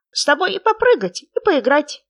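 high, loud, squeaky little frog; small creature's high, loud voice. A narrator's reading. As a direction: pipe up eagerly as a tiny frog. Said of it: "сказал лягушонок".

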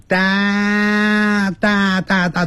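大 held long and high, wide open "daa"; drawn-out open 大. Shown as a demonstration. Teacher drills the vowel of downtown, 大 long and high. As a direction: stretch 大 with a wide-open mouth, pitched high. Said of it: "先念大小的大".

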